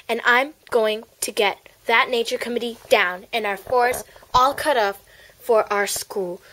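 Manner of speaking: ordinary delivery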